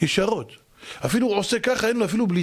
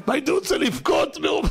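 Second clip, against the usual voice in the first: high exclaimed near-wail; mock wail; 7 semitones higher than usual. Lecturer recalling a hopeless gym-goer, high mock wailing. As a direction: pitch it up as if overcome.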